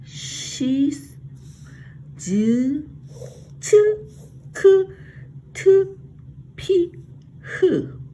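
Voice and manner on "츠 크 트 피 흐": 츠 크 트 피 흐 each said high and loud, one by one; repeat after me. Shown as a demonstration. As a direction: call out 츠 크 트 피 흐 clearly as a model. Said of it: "shall we repeat?".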